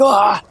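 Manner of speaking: short high-pitched yelp; startled yell. Acted. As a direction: yelp high and loud in surprise. Startled men turn around.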